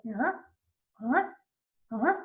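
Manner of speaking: short whoop swooping sharply from low to high; imitated rising chirp. Shown as a demonstration. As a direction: imitate a quick upward frequency sweep. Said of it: "starts at low pitch and then ends at a high pitch".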